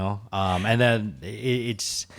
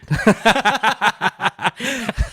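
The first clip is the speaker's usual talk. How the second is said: high laugh; 10 dB louder than usual. Real, not acted. Husband laughing at his own cluelessness, real laugh. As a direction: burst of high, loud laughter.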